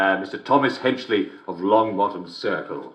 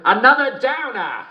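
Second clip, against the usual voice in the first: high, crowing joke payoff; gleeful punchline; 12 semitones higher, 4 dB louder than usual. Acted. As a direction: crow the punchline high, delighted. Delivers punchline with glee.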